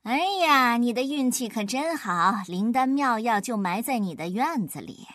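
ordinary delivery

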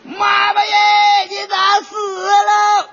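high, slow hammed-up crying shout; mock wail, corrected. Son-in-law fixing his mistake, wailing for mother-in-law. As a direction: howl for mom, drawn out.

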